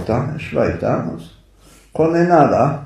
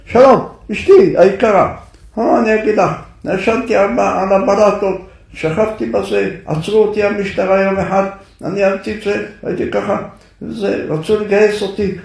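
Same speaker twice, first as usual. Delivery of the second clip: shouted letter reading; 7 semitones higher than usual. Retold or imitated. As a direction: bellow the husband's letter at her. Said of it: "הוא צועק".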